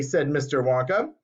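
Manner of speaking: plain reading voice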